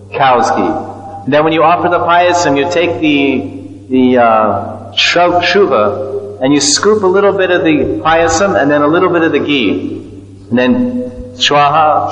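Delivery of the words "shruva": ordinary talk, nothing marked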